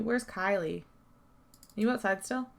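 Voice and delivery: ordinary talk, nothing marked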